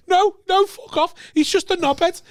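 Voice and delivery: high-pitched, loud exasperated protest; retold angry outburst. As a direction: shout down everyone's sympathy, voice pitched right up. Retold outburst at fake bereavement.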